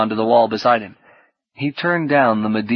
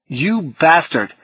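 slow, higher, pained accusation; something like rage; 6 semitones higher, 3 dB louder, slower than usual. Acted. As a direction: draw out the insult in hurt anger. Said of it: "Orlando felt something like rage".

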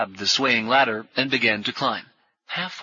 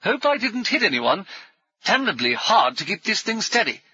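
high, raised voice calling down; breezy adventurer, pitched high; hearty airship pilot; 10 semitones higher than usual. A narrator's reading. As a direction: call down jauntily to those below. Brummond calls down from airship.

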